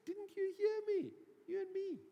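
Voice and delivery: high-pitched, acted disbelief; incredulous protest. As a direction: play Peter, stung and disbelieving. Peter protests Jesus's prediction.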